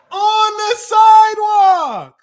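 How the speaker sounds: high, loud, slow disbelieving yell. Real, not acted. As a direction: shriek the sidewalk part. Repeat, loud take.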